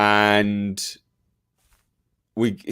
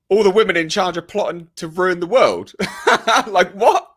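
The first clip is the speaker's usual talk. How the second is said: laughing disbelief; 8 semitones higher, 3 dB louder than usual. Real, not acted. Laughing at absurd plot.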